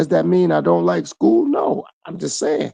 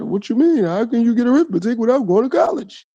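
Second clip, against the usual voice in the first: higher voice of a skeptic; mocking doubter; 6 semitones higher than usual. Imitating people who doubt him, higher and incredulous. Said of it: "But I say that".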